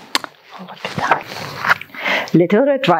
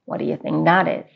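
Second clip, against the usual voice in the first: plain reading voice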